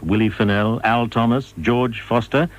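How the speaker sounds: normal talking voice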